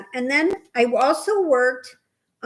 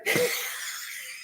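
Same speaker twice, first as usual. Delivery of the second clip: a burst of high breathy laughter; 12 semitones higher, 7 dB quieter than usual. Real, not acted. Laughing at the policeman's joke, breathy high laugh.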